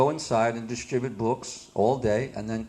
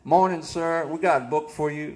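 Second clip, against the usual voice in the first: put-on slightly raised Southern country accent; 3 semitones higher than usual. Book distributor greeting a local, put-on Southern accent. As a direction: imitate a Kentucky accent to pitch a book. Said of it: "I had to talk like that".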